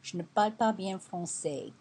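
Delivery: je ne parle pas bien français spoken in French, stress on pas; apologetic French phrase. Shown as a demonstration. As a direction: say it like a learner in a shop. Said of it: "one of the best phrases I learned was".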